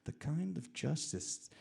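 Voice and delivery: loud, emphatic narration; loud and intense storytelling; dramatic story reading. A narrator's reading. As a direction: declaim the story with force. Reads own story forcefully.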